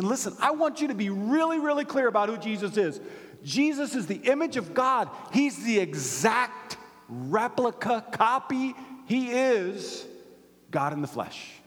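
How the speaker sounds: high, forceful proclamation as Paul; emphatic preaching. Acted. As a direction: hammer each claim home like a preacher. Preaches in Paul's words.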